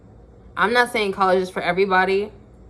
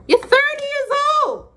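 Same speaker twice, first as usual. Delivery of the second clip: very high-pitched disbelief; incredulous outburst. Real, not acted. Scolding thirty-year-olds over their music, incredulous outburst. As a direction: exclaim it way up high, appalled. Incredulous at grown listeners' taste.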